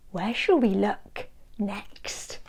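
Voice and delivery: soft unvoiced whisper; conspiratorial storytime whisper. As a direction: whisper it like a secret plan. Sneaking up quietly on Spot.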